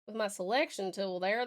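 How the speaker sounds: ordinary talk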